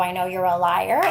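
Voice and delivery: ordinary delivery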